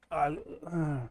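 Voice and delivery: quiet, groggy groan. Acted. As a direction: come round slowly, dazed. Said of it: "She's really groggy. She's like".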